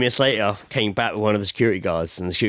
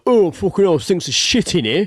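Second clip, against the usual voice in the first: louder, higher mock complaint; imitated lad walking in; 8 semitones higher, 4 dB louder, slower than usual. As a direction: act out a lad loudly announcing the stink. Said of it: "one of them just go".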